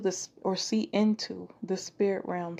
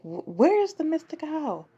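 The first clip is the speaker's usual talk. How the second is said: high, louder grandma call; calling out for the kid in the morning; 8 semitones higher, 4 dB louder than usual. Retold or imitated. Grandma searching for the girl, high calling voice. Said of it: "I remember hearing my grandma".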